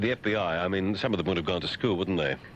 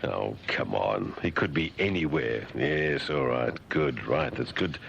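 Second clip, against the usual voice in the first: weary sarcasm; 6 semitones lower, slower than usual. Acted. Dismissive, low mocking of FBI.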